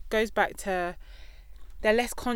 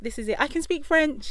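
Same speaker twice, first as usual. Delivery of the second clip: put-on boastful kid; 8 semitones higher, 5 dB louder than usual. Mimicking a boastful child.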